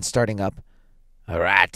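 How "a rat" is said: put-on louder, shaking old man's reply; shaking tones. A narrator's reading. Old man explaining the knock to his wife, shaking tones. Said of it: "said the old man in his shaking tones".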